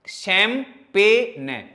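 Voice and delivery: शैंपेन drawn out very slowly, g hidden; slow clear pronunciation. Shown as a demonstration. Teacher repeats शैंपेन slowly for the class. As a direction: drag each part of शैंपेन. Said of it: "इसको छुपा लो".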